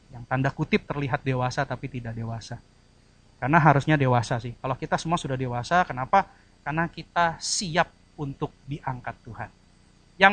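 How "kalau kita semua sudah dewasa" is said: ordinary talk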